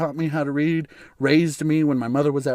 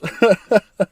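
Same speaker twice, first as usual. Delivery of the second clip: laughter; 5 semitones higher, 6 dB louder than usual. Real, not acted. Laughing at the impression.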